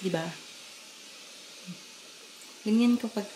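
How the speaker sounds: ordinary delivery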